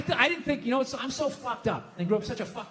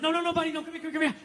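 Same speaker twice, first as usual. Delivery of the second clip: higher, hurried reassurance; urgent soothing; 7 semitones higher, faster than usual. Retold or imitated. Dad rushing to his crying daughter, acted urgent soothing. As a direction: scramble to calm a child.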